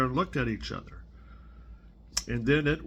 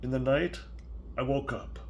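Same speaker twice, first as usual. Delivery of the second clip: put-on reading to a child; 4 dB quieter than usual. Grandparent reading Salt Hands to a small child, gentle storybook voice. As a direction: read it softly, like a bedtime story. Reads picture book aloud.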